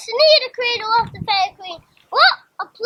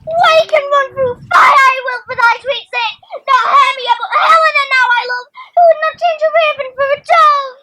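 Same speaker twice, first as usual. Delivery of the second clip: loud, higher, ardent outpouring of love; passionate declaration; 7 semitones higher, 7 dB louder than usual. Acted. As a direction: declare love loudly to Helena, as if spellbound. Lysander wakes enchanted, declaring love.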